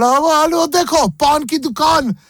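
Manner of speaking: a hammed-up Indian uncle; bad Indian accent. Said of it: "that was bad anyway".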